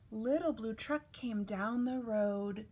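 usual speaking voice

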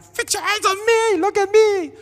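high, loud scolding yell; exasperated yelling. Retold or imitated. Camp leader to kid refusing eye contact, exasperated yelling. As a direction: demand attention at the top of your voice.

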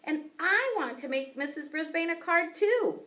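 high, loud upset squeak; squeaky hamster rant. A narrator's reading. Hamster complaining to Og about the class, high upset squeak. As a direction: pour out the worries in a tiny high voice.

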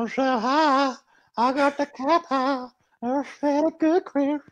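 high, drawn-out cartoon old pervert voice; slow falsetto-like character impression; Herbert the Pervert. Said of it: "I meant that the".

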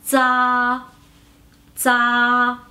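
"za" as a buzzing dz plus a long level a; Mandarin ZA. Shown as a demonstration. Teacher combining Z and A for learners, "za" drawn out level. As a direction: hold "za" on one even pitch, starting with unaspirated dz. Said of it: "la Z A se pronuncia".

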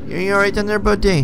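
high, loud call into the room. Real, not acted. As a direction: call out to someone hiding, pitched well up. Calling to hidden game character.

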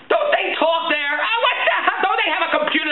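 high-pitched incredulous rant; exasperated. Retold or imitated. Customer ranting about staff who don't talk, exasperated. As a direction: wail in comic outrage at their incompetence.

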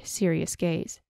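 ordinary delivery

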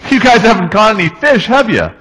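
nosy busybody yelling at the fishermen. Retold or imitated. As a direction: call it out teasingly across the water. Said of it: "he yells out".

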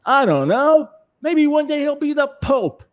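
hammed-up fuming father.

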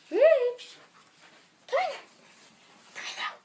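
loud high call; calling the dog. Real, not acted.

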